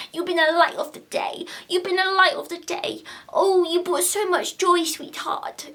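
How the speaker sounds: pitched high, sugary and adoring; patronising gushing. Retold or imitated. Vlogger mocking interviewers, high patronising coo. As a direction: coo at a toddler. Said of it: "talking to her like she's a toddler".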